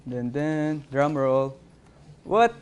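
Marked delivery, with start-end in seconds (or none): 2.2-2.5 surprised. Real, not acted. Surprise at recognition result.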